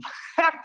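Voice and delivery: quick high burst of laughter; real amused laugh; short laugh. Real, not acted. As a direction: laugh it off briefly. Laughing after movie quote.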